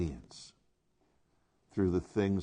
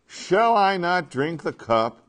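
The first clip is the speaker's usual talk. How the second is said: loud, raised, slow and ringing; resolute declaration; 7 semitones higher, 6 dB louder, slower than usual. Acted. Jesus accepting the cup after arrest, resolute and loud. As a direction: declare it with raised, emphatic force.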